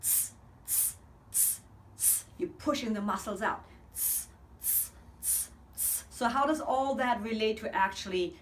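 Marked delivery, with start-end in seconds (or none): 0.0-6.0 short unvoiced tss pulses; hissed tss bursts. Shown as a demonstration. Singing coach shows muscle-pumping tss hisses. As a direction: push out sharp tss hisses. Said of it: "and on a T S".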